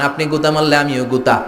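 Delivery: ordinary talk, nothing marked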